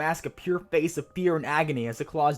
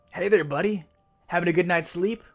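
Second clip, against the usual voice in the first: casual friendly greeting; 7 semitones higher, 6 dB louder than usual. Acted. Casually greeting the mirror creature.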